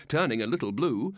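plain reading voice

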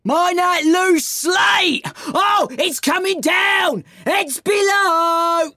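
high, drawn-out yells of warning; alarmed warning shout. Acted. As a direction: cry out as it comes crashing down. Workers warn of falling slate.

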